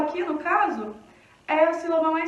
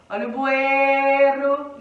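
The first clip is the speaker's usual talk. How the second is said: loud, slow hawking with "ei" in "bueiro" held; market vendor's cry; 5 dB louder, much slower than usual. Shown as a demonstration. Teacher announcing bueiro at the fair, loud slow vendor's cry. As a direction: hawk it loudly, dragging the "ei" of "bueiro". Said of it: "Ou anuncia o bueiro na feira".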